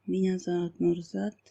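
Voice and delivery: ordinary talk, nothing marked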